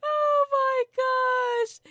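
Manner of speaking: high, loud, slow stretched cry; real excited squeal; shrieking excitement. Real, not acted. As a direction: squeal it in delighted shock. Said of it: "reacting to villain song".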